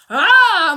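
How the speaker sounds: loud mock bear roar, laughing; a loud, hammed-up protective growl; mama bear roar. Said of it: "Mama Bear came out".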